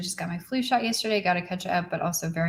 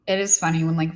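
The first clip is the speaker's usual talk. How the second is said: ordinary talk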